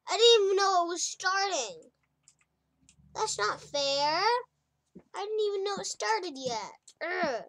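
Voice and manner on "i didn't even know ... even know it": high, loud complaint falling away; indignant whine. Real, not acted. Gamer who missed the start, indignant loud whine. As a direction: protest loudly at being caught out. Protests a round starting unnoticed.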